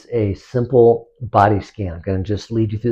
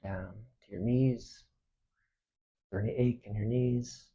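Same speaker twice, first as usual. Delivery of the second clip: soft, unhurried meditation voice with long pauses; hushed, spacious relaxation guidance; guided body scan meditation; 12 dB quieter than usual. Acted. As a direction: guide them gently through the body, quietly.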